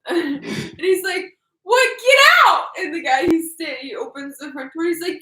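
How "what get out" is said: high, loud hammed-up shout; mock outraged yell. Acting out brother ordering prankster out, mock yelling.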